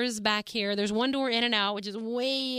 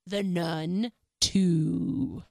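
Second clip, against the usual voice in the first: slow, drawn-out announcer build to the title; dramatic reveal; much slower than usual. Acted. Vlogger unveiling her long-teased review, slow dramatic announcer voice. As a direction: stretch it out like a movie trailer reveal. The moment you've all been waiting for.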